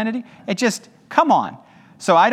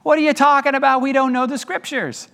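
higher, offended protest of the scholars; indignant Sadducees; 8 semitones higher than usual. Retold or imitated. Sadducees protesting to Jesus, higher and indignant. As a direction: act out their wounded objection. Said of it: "They would say".

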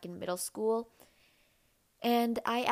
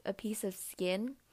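usual speaking voice

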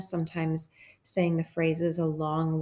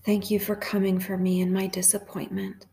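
hushed, even prayer with long pauses; guided meditation prayer. Real, not acted.

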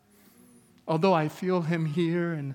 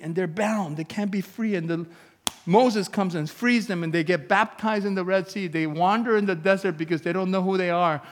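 preaching; 4 semitones higher, 5 dB louder than usual. Acted. Preaching builds on the ark story.

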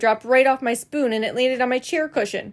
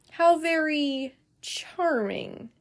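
slow, soft, stiffly polite; dry politeness; 4 semitones higher, 5 dB quieter, much slower than usual. Acted. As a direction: drawl it out as a tight-lipped grandma. Nana's stiff dry reply.

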